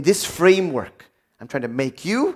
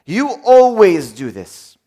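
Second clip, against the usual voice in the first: slow, louder accusing tone; accusing criticism; 7 dB louder, slower than usual. Shown as a demonstration. A spouse criticising their partner, slow and accusing. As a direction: lay the blame on the spouse, drawn out. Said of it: "criticism is".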